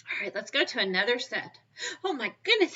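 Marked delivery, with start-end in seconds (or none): 2.0-2.8 high, loud pretend astonishment; mock amazement. Teacher showing children a bunch of ants, mock amazement. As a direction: play up the wonder for little ones.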